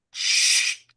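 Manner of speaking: hushed hissing "shh" of drawn steel; imitated blade sound. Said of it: "I knew what that sound was".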